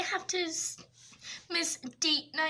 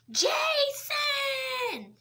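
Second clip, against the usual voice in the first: high shout across the room; calling out; 11 semitones higher than usual. Acted. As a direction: yell his name to get attention. Calling out to colleague.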